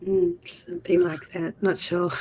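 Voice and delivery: raspy, worn-out voice; talking with no voice left; hoarse voice. Real, not acted. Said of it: "just the voice is gone".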